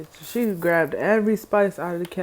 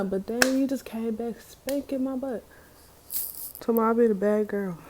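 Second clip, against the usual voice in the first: ordinary talk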